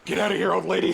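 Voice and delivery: yelling. Acted. Shooing the old lady away.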